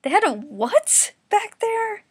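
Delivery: high, loud, slow shocked cry; gasping shock. Acted. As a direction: gasp the question in disbelief. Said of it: "Yusuke gasped again".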